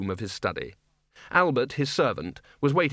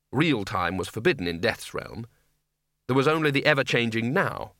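ordinary delivery, nothing marked

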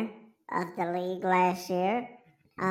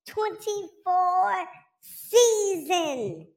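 drawn-out, higher hype announcement; announcer voice; 10 semitones higher, much slower than usual. Pretend. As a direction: stretch it out like a big-event host. Announcing the draft season.

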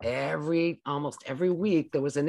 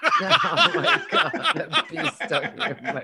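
laughing; 12 semitones higher, 5 dB louder than usual. Real, not acted. Friend repeating the toddler's line while laughing. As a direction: laugh through the words.

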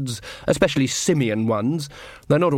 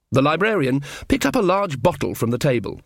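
ordinary delivery

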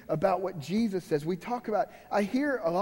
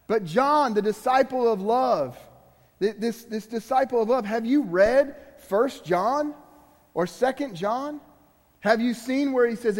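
higher and louder, slowed at the questions; impassioned preaching; 4 semitones higher, 5 dB louder than usual. Acted. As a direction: press the congregation hard.

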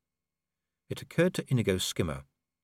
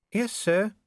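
nervous Cheery; 12 semitones higher than usual. A narrator's reading. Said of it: "said Cheery nervously".

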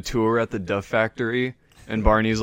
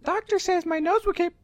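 Ralph Wiggum voice. Retold or imitated.

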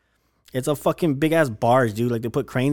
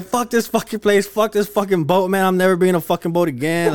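higher, louder mock rant, laughing through it; furious rant; 7 semitones higher, 4 dB louder than usual. Retelling the boat ramp disaster, a laughing mock rant. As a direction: storm off cursing the place. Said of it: "and I was just like".

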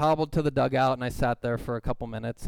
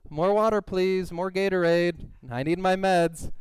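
needy patient; 6 semitones higher, 4 dB louder than usual. Retold or imitated. Milking it, bedridden demands.

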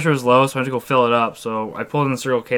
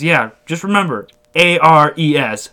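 higher, louder stranger with slow spelling; put-on insistent stranger voice; Frankie; 5 semitones higher, 6 dB louder than usual. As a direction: imitate a guy drilling in his contact details. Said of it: "He goes".

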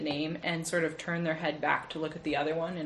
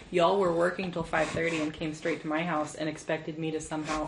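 ordinary talk, nothing marked

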